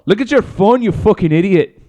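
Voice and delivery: high mock-furious wife; hammed-up scolding with a put-on accent; Conor's wife. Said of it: "you Connor's wife".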